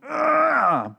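long, high mock straining groan; drawn-out toilet-strain grunt, hammed up. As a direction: groan as if pushing hard. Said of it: "on something while taking a shit".

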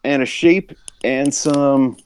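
lightly lampoon a folksy guest making demands; imitating Aaron Frederick. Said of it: "He's like".

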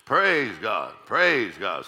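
hard-punched praise, each phrase falling away; mama's praise. Retold or imitated. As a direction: imitate mama's loud, rhythmic string of praises. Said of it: "So my mama".

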